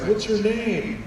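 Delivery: high, bright What's your name; chirpy photographer. Retold or imitated. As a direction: ask like a cheery stranger. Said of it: "this guy's like".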